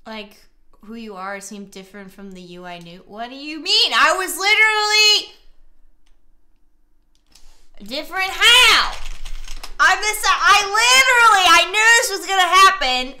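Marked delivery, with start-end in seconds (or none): 3.1-13.1 loud, high-pitched protest of disbelief; exasperated outburst. Real, not acted. Player protesting to a game partner's accusation, exasperated yelling. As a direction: yell in frustrated self-defence.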